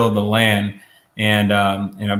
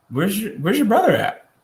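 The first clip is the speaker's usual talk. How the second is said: high, probing question from above; God asking; 9 semitones higher than usual. Retold or imitated. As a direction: ask it like you already know. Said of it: "God asks him, he says".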